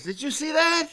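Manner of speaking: high-pitched, loud, amazed bystander voice; excited bystander. Retold or imitated. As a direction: play the wide-eyed guy who saw it all. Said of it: "He goes".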